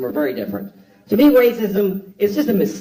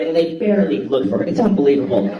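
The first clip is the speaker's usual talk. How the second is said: usual speaking voice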